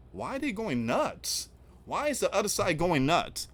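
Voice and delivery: high-pitched, baffled questioning; incredulous questioning. Acted. Host asks viewers about the other side, high and incredulous. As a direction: pitch it right up in disbelief. Mock bafflement at opponents' reaction.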